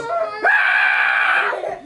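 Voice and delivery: loud low startled scream. Retold or imitated. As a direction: scream in shock at the blood. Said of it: "she goes".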